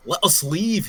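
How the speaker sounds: put-on corrected take in a Scottish accent. GM voicing the Scottish guard to the party, Scottish accent. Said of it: "He's a Scottish".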